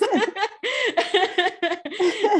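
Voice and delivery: high bubbling giggle; delighted laughter; giggling laugh. Real, not acted. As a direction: break into a long high giggle. Laughing at her own story.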